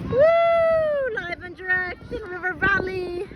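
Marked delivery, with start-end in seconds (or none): 0.1-1.1 long, high whoop; cheering woo. Real, not acted. Cheering the Canada Day fireworks, high whoop. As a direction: give a high, drawn-out woo.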